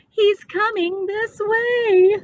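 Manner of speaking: put-on high, slow frightened child near tears; a frightened boy warns of the bear, near tears; scared whimper. A narrator's reading. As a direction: tremble out the warning.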